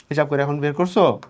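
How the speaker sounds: ordinary talk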